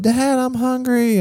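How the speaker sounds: put-on high, soft, drawn-out kid's plea; small high voice calling for dad; lightly voiced hungry child; imitated daughter. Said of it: "she'll come up".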